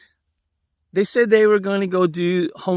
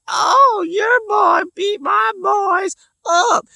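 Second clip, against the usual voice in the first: much higher, louder, slow whiny yell; hammed-up shrieking complaint of an outraged mom; mock angry neighbor; 3 dB louder, much slower than usual.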